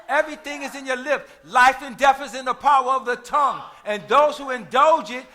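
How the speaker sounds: loud, driving sermon cadence that builds to a shout; full-force preaching. Real, not acted. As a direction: hammer each declaration home to the congregation, building.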